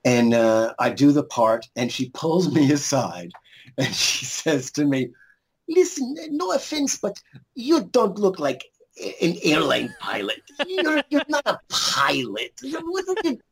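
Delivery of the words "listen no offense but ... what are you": much higher, slower, put-on Russian accent for the director; imitating a stern director in a heavy Russian accent; mock Russian accent. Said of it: "I'm doing, like, a Russian accent".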